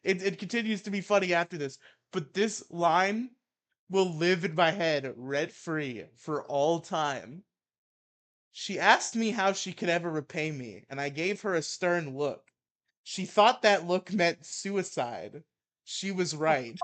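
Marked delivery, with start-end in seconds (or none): 2.1-7.4 slow, loud, savoring each word; slow emphatic. Acted. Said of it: "drawn-out emphatic announcement". 8.5-16.8 heated mock-angry reading; ranting article read. Reads absurd story aloud.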